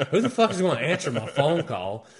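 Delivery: loud, high-pitched mock outrage, half laughing; retold exasperation. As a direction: gripe loudly with a laugh in it. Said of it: "I was pissed about that too".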